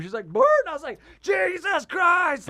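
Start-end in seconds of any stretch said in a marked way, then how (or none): 0.3-0.4 short imitated dog squeal; quick yelped burst; shocked dog yelp. 1.2-2.5 drawn-out, high-pitched yell; loud horrified cry, retold at full voice; alarmed shout. Retold alarm at dog shock.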